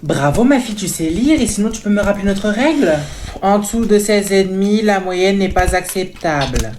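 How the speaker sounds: loud, overbearing mom voice; loud pushy mother; loud mother character. Acted. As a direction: play the pushy mother booming over everyone.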